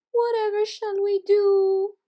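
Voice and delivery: very high, loud, slow wail; desperate cry. Acted. Poodle mother who lost her pup, desperate high wail. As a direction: wail it out in despair. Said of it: "cried out in desperation".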